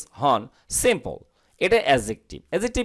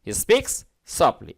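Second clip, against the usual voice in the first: speaks said very slowly and clearly; teacher slowly shows how speaks should sound; slow pronunciation demo; much slower than usual. Shown as a demonstration. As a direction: model the word slowly for students. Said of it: "এটা speak হবে না কিন্তু".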